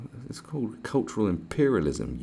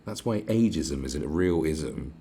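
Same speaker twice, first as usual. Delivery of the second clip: usual speaking voice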